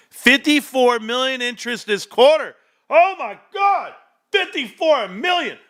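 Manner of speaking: excited. Real, not acted. Streamer thrilled by earnings numbers.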